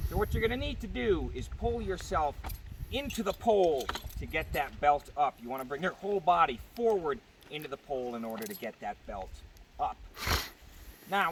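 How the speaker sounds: ordinary delivery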